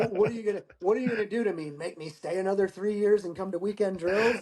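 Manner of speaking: retold defiance. Retold defiant challenge to superiors.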